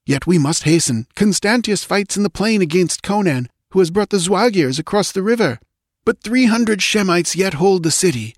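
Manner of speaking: voix haute de soldat qui presse la reine; vite, il faut fuir; sauveteur pressant. A narrator's reading. Rescuer speaks to queen.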